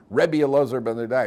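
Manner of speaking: loud announcement of the name; heavenly voice. Acted. Heavenly voice calls out the sinner's new title, loudly.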